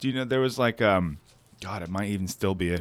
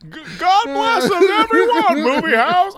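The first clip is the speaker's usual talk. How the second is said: very high, strained, louder stammering voice; Jimmy Stewart impression; 7 dB louder than usual. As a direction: push way up into a frantic old-film stammer. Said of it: "Jimmy Stewart".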